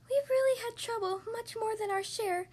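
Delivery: high, small distressed voice; tiny mayor pleading for help; high-pitched little voice, plaintive. A narrator's reading. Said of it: "pleaded the voice of the mayor's".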